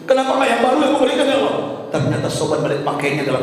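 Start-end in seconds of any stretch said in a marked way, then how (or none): 0.1-1.6 quick, high-pitched imitated cry of regret; regretful plea. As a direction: rush it out, pitched right up. Dying man's regret, second take.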